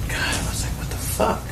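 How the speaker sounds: whispered disbelief; hushed, shocked whisper. Retold or imitated.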